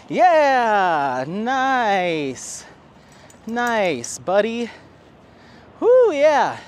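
high, loud praise; excited praise. Real, not acted. Praising her dog after the course, high loud cheer.

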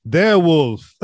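hammy, booming gag delivery; Feldman. Retold or imitated. Finishing the werewolf gag as the actor, loud and hammy. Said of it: "And then Feldman's like".

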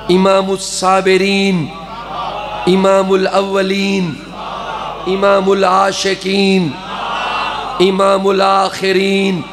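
chanted list of Ali's titles; devotional recitation. Acted. Reciter honouring Ali before the crowd, chanting. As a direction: intone each title with reverence.

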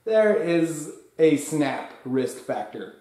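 ordinary talk, nothing marked

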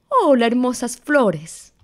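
high storybook mouse greeting; ratón muy alto. Acted. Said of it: "ratón muy alto decía". (saludo de ratón, algo agudo)

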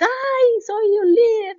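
high, whiny mock euphoria, almost crying; hammed-up euphoric self-praise; euphoric mock leader. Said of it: "no es euforia". (voz eufórica exagerada, casi llorosa)

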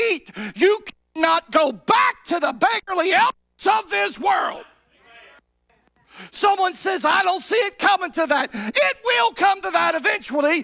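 high, loud, shouted sermon climax; hollering revival preacher who won't give an inch; preaching at full force. Acted. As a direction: whip the congregation up, pitch rising, voice at full stretch.

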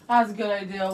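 low, soft sarcasm; sarcastic. Real, not acted. Vlogger joking to viewers about going without a friend, sarcastic. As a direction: say it with dry sarcasm, voice dropped. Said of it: "I'm just being sarcastic".

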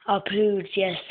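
ordinary talk

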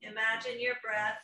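a lightly soothing yoga teacher guiding a meditation; guided meditation. Acted. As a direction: ease the class into calm. Leads a guided relaxation.

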